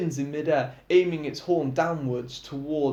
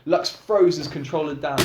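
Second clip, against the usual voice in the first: usual speaking voice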